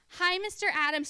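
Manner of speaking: mock-innocent child greeting; sweet kid greeting. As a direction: chirp it like an innocent little girl. Camper sweetly greeting counselor.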